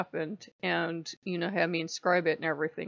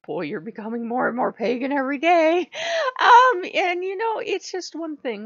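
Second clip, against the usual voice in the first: excited; 9 semitones higher, 8 dB louder than usual. Real, not acted. Teasing aside, emotional.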